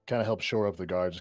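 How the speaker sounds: normal talking voice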